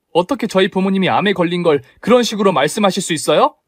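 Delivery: daughter-in-law defending her sick father to her mother-in-law; confronting. Acted. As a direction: challenge her point by point.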